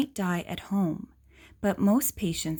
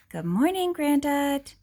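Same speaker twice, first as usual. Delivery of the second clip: put-on higher, brighter child's greeting; young badger; 6 semitones higher, 3 dB louder than usual. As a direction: greet Granddad like a little cub.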